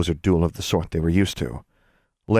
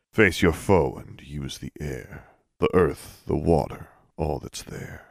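slow, lower chanted verse; low rhythmic cadence; 4 semitones lower, 4 dB quieter than usual. A narrator's reading. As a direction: intone it like an incantation. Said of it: "spoke in a low, rhythmic cadence".

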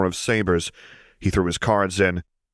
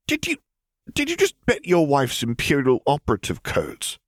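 shocked; 7 semitones higher than usual. A narrator's reading. Piet choking on his drink.

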